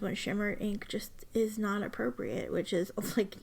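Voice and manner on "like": ordinary talk, nothing marked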